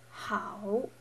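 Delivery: "how" dipping then rising; third tone. Shown as a demonstration. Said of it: "pronunciation tones".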